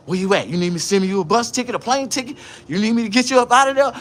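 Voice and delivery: mother eagerly offering to get her son out; eager mama. Retold or imitated. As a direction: rush in to rescue your baby. Mama's eager reply.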